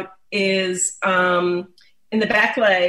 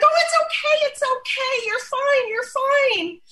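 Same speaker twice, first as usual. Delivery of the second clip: put-on much higher, gushing baby-talk comfort; the wrong way: high, fluttery, overexcited soothing; excited squeaky reassurance. Shown as a demonstration. Owner fussing over an upset dog in a high excited voice. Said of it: "that's just gonna excite the dog more".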